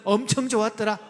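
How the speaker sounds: loud Chungcheong dialect demo; rousing Chungcheong version. Shown as a demonstration. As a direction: proclaim it in a Chungcheong accent. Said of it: "충청도 버전".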